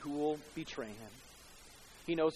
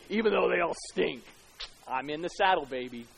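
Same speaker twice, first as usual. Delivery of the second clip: mock swagger; 6 dB louder than usual. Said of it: "Which is brilliant".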